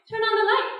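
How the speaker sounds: loud, high, freaked-out order; freaking out. Acted. Woman thinking her lover a weirdo, freaking out. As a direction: demand it in rising alarm. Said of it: "freaking out in the dark".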